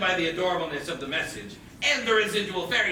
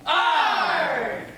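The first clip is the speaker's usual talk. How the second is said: pirate arr; 8 semitones higher than usual. Acted. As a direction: bark it like a pirate. Pirate growl.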